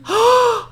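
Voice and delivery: loud high mock gasp; shocked gasp. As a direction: gasp as if scandalized.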